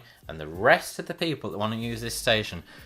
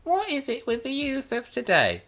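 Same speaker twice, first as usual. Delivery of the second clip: much higher, hammed-up indignant squeal; mock outrage; 3 dB louder than usual. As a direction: pitch it way up in mock disbelief. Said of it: "But seriously".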